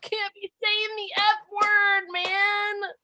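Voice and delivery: high, hammed-up plea of a parent; mock parental pleading. Parent retelling warning a child before school, mock pleading. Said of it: "And I'm like".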